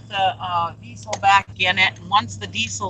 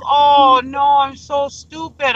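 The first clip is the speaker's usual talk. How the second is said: slow, higher retold wail; loud, drawn-out "oh" sinking into dismay; distraught self-blame; 4 semitones higher, 4 dB louder, slower than usual. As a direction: imitate someone kicking herself. Retells friend blaming herself.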